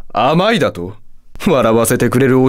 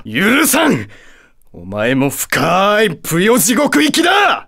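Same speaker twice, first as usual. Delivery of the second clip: high, drawn-out shouted condemnation; furious sentencing; 5 semitones higher, slower than usual. Acted. Satan furious at being lectured, shouting doom. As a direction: stretch the threat slowly into a raging cry.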